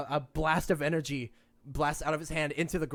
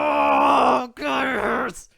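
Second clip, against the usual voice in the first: loud, high wail of pain; pained shout; 8 semitones higher, 11 dB louder, much slower than usual. Acted. The captive shouts at the party about his pain. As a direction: howl it out, it really hurts.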